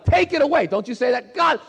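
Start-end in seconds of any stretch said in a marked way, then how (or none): 0.1-0.7 high, loud desperate plea; crying out to God. Retold or imitated. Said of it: "I cried out to God". 1.3-1.6 acted high-pitched, urgent plea; crying out to God. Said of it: "I cried out to God".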